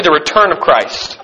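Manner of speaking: ordinary delivery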